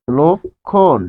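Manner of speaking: "লক্ষণ" drawn out as lok... khon; rounded lo, split. Shown as a demonstration. Teacher models the word twice, "লক্ষণ" split as lok... khon. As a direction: break "লক্ষণ" in two slow halves, lok and khon. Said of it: "তাহলে কি হচ্ছে?".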